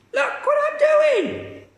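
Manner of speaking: put-on very high, loud gleeful old woman; shout. Grandma shouting from the roof, very high and loud. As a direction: crow down to the boy.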